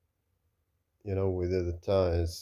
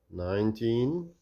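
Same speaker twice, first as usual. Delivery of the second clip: ordinary talk, nothing marked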